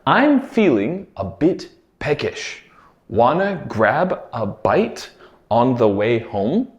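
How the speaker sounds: slow, careful dictation pace; slow clear repeat. Shown as a demonstration. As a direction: spell it out slowly for learners. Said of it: "This phrase was".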